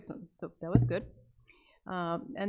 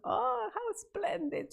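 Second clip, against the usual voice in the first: high, slow squeal of delight; a girl's high cry of delight at danger; delighted shriek; 13 semitones higher, slower than usual. Retold or imitated. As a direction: squeal it out, thrilled by the wave. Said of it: "And Amy shrieked".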